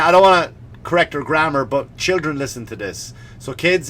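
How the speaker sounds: usual speaking voice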